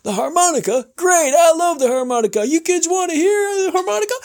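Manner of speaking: high, rising showman pitch; excited radio host. Retold or imitated. Host imitated asking kids about harmonica, excited. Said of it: "He says".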